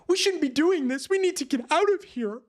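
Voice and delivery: high-pitched pleading to get out; mild mock panic. Rescued survivors urging the crew to quit the hunt, mock alarm.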